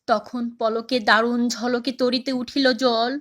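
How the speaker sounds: declamatory recital building, then hushed at the close; poem recitation. Acted. Recites the poem's climax. (আবেগময় কবিতা আবৃত্তি, শেষে মৃদু স্বর)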